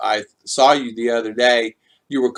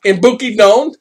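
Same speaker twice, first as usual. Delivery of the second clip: normal talking voice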